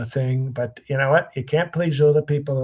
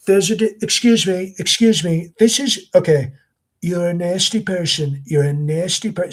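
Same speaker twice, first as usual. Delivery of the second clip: higher-pitched mock Trump impression; Godfrey; 4 semitones higher, 4 dB louder than usual. Shown as a demonstration. Impressionist mocking a rival's Trump, high parody voice. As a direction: do a rival comic's lifted, generic Trump voice. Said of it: "can I do the Godfrey one for you".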